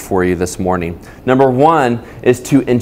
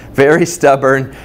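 ordinary delivery

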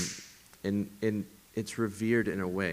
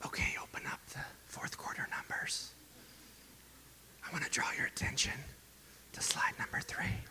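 whispering a business presentation; breathy hushed boardroom whisper, played for laughs; mock whisper; 5 semitones higher, 11 dB quieter than usual. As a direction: whisper the slides like a secret. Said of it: "I don't picture you this week going into your business meeting".

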